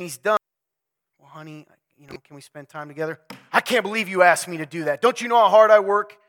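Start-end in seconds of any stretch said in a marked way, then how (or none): 1.2-3.2 soft, lower, timid plea; timid wife. Retold or imitated. Timid wife quietly asks her husband for time together. 3.5-6.2 louder, indignant snap; indignant husband. Retold or imitated. Husband snaps at his wife for asking too much.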